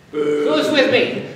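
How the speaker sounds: loud, slow rallying cry, laughing; rally the troops, half laughing; roaring rallying speech. Pretend.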